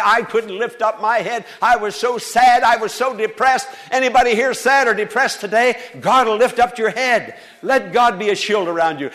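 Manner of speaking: loud, rising pulpit preaching; fervent preaching. Acted. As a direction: preach it out with full force to the congregation. Preacher at full force.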